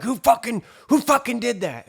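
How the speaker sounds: high, loud mimicked demand; mad housemate. Housemate accusing a roomful of people, mimicked angry yelling. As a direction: imitate someone freaking out in fury. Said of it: "he's madder than fuck".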